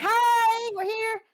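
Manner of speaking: high, loud, drawn-out hello; cheery greeting. Acted. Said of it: "and be like".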